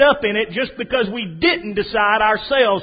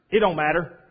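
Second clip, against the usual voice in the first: ordinary talk, nothing marked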